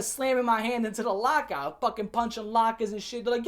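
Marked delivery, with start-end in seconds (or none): none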